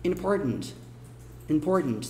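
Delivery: "important" in relaxed New York style, T swallowed; New York "important", soft T held in the throat; New York American. Shown as a demonstration. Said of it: "inglês bem mais nova-iorquino".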